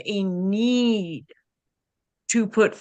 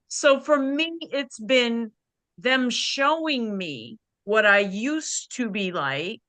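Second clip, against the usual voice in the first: ordinary talk, nothing marked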